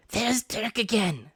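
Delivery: pirate voice. Acted. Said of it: "said one".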